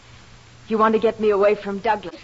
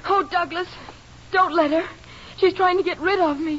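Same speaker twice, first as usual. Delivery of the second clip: frightened plea; 9 semitones higher than usual. Acted. Evelyn pleads, feigning fear.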